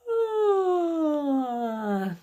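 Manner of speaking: a big pretend yawn; demo yawn. Shown as a demonstration. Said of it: "Can everybody yawn? Go".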